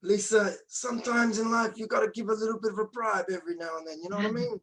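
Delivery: high mobster-style put-on accent, unsure whether it's Russian; Godfather voice. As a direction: play it like a mock mafia don. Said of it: "Was that The Godfather?".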